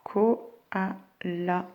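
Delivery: Koala softly as ko... a... lla; split into syllables. Shown as a demonstration. Teacher helping find L, Koala softly syllable by syllable. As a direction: softly space out "Koala", lingering into "la". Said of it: "Où c'est que j'entends L?".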